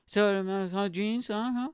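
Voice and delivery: high, slurred mock Scouse; hardcore Scouse accent. Passenger pointing at the jeans, in high, garbled Scouse. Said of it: "Crazy accent".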